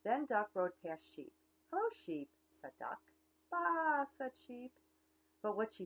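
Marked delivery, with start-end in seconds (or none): 1.7-2.3 high, bright little duck greeting; cheery duck. Acted. Duck greets the cow from his bike, high chirpy hello. 3.5-4.1 high bleating baa; mock sheep baa. Sheep answers the duck, high bleat.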